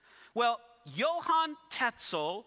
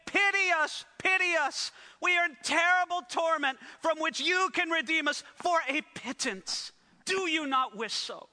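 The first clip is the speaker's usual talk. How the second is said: pleading dead souls; 5 semitones higher than usual. Retold or imitated. Souls in purgatory begging their relatives, mock wailing plea. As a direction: beg pitifully, hammed-up.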